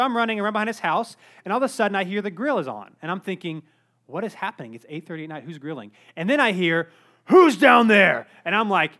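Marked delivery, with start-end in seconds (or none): 4.1-5.9 dropped to a soft, worried hush; thinking it to yourself, quietly alarmed; softer muttered thought to self; hushed inner thought. Retold or imitated. Inner panicked thought, dark yard. 7.3-8.3 slow, much louder gruff call; big burly neighbor barking from the porch; neighbor shouting. Retold or imitated.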